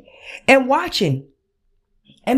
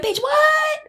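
very high, shouted disbelief; squealing high mock shock. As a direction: yelp it like you can't believe it. Friend's shocked reply.

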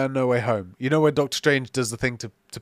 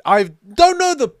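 frustrated outburst; 12 semitones higher, 6 dB louder than usual. Real, not acted. Host loses his place mid-plug.

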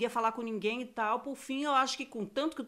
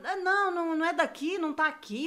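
higher, flustered mother on the phone; a lightly voiced flustered mother; voicing the mother; 6 semitones higher than usual. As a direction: act the confused old lady answering.

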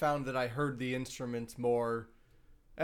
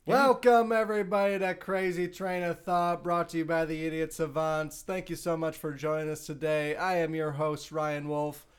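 podcast intro. Acted. Podcast host opening.

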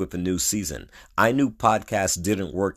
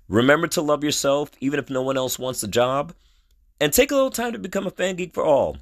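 show sign-off; 7 semitones higher, faster than usual. Acted. Signature sign-off.

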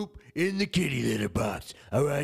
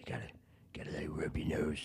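soft unvoiced whisper demo; demonstrating ASMR in a whisper; 8 semitones lower, 10 dB quieter, faster than usual. Shown as a demonstration. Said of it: "It goes a little something like this".

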